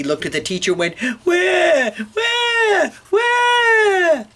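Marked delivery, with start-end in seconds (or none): 1.3-4.2 put-on loud, very high baby wail. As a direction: bawl like a baby in three long bursts. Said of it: "So the baby cried".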